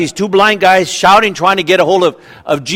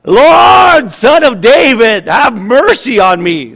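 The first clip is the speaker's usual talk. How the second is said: loud high cry, slowing on the plea; shouting louder; 6 semitones higher, 8 dB louder, slower than usual. Acted. Blind men crying out louder to Jesus for mercy. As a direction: call out over the crowd, desperate. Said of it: "they only shouted louder".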